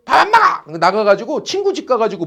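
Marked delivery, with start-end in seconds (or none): 0.1-0.8 high-pitched sulky refusal; sulking kid. Retold or imitated. Teacher mimicking a sulking kid refusing dinner, mock huffy. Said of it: "어떤 놈은 막 삐져갖고".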